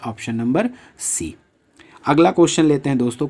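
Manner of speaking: normal talking voice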